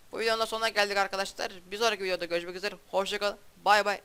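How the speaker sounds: quick, upbeat sign-off read; youtuber outro. Acted. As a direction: rattle off the goodbye brightly. Closing outro of video. (hızlı, neşeli video kapanışı)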